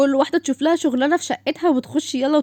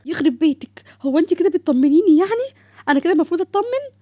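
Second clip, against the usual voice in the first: indignant; 7 semitones higher, 5 dB louder than usual. Acted. Indignant at unhelpful comfort.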